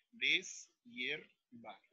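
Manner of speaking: slow syllable repeat of "Deshiervar". Shown as a demonstration. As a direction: say "Deshiervar" softly, one syllable at a time. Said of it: "Y digo entonces".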